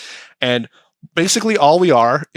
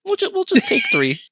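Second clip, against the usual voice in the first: greedy California; 11 semitones higher than usual. Retold or imitated. California state whining to the taxpayer for its cut, high and wheedling. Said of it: "California came in and went".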